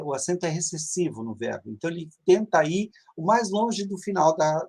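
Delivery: ordinary talk, nothing marked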